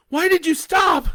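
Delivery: high, loud alarmed shout. Acted. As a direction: Leah shouting at Bo as he stops the truck, high and loud. Leah shouts at Bo.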